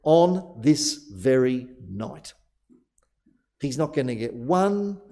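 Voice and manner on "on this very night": "on this very night." slow, each word weighted; emphasis on this very night. Shown as a demonstration. Preacher to congregation, showing the Greek emphasis, slow and weighted. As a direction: lean into "on this very night." slowly. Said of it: "he shows where the emphasis in the Greek lies".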